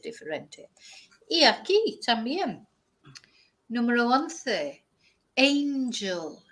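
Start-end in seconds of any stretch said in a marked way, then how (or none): 5.4-6.4 "angel" slowly, AYN-jul; drawn-out English "angel"; English pronunciation. Shown as a demonstration. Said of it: "in English".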